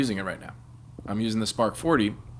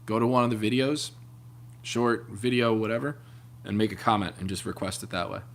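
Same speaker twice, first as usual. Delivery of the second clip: ordinary talk, nothing marked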